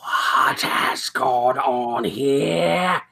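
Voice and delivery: slow, drawn-out menacing whisper; eerie whisper. Acted. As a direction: whisper like an ancient Fae. Fae lord appears.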